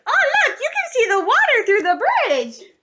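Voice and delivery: high, loud, laughing delight; thrilled kid. Retold or imitated. Child shows mom the water below, laughing and thrilled. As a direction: squeal it like an excited eleven-year-old.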